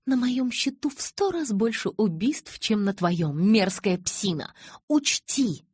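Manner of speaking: high female character voice full of venom; hissed threat. A narrator's reading. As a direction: threaten him icily, ending on a sharp warning. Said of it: "Розали прошипела". (злобное шипение высоким женским голосом)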